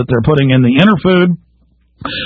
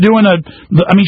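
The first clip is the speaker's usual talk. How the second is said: normal talking voice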